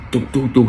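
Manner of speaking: rapid "do do do" gun sounds; gunfire imitation. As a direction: rattle it off like a machine gun.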